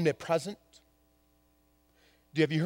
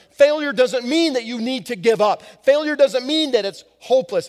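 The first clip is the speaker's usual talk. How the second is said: louder, higher rising exhortation; fervent preaching; 6 semitones higher, 6 dB louder than usual. Acted. Pastor urges congregation not to give up, fervent. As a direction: lift the crowd out of despair.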